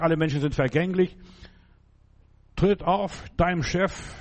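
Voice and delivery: normal talking voice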